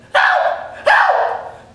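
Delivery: high falsetto scream, loud; high-voiced swimmer screams at the shark; screaming for help. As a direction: yell for help in a squeaky voice. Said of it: "he begins screaming for help".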